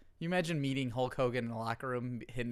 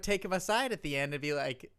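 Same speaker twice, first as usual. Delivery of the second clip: ordinary talk, nothing marked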